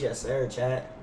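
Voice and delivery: ordinary talk, nothing marked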